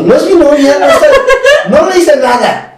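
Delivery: high, loud comic self-defence; flustered protest. Retold or imitated. Man mistaken for a crying girl's boyfriend, flustered protest. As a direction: protest to everyone, mock panicked.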